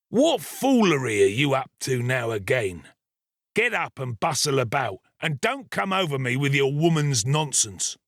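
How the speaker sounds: a touch of a bullying ruffian's temper; savage. A narrator's reading. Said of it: "in a savage voice".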